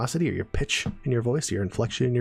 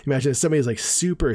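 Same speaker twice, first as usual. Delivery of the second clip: normal talking voice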